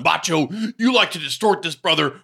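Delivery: hammed-up wrestler promo impression; Hulk Hogan. Wrestler replying to partner in interview, mock promo. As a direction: answer back like an indignant wrestling hero. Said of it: "Hulk would have an interview".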